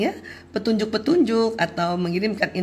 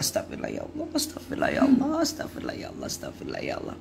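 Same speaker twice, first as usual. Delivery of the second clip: barely audible whisper, trailing off; whispered istighfar; 3 semitones higher, 7 dB quieter, faster than usual. Shown as a demonstration. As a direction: breathe the prayer out to yourself. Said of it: "perempuan yang istighfar duluan".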